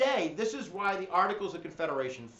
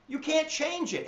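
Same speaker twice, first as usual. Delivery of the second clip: usual speaking voice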